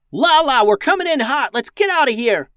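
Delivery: high, loud urgent shout; yell into comms. A narrator's reading. Captain radios pilot while fleeing, urgent yelling. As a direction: shout for an emergency getaway. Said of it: "Max yelled into her comms".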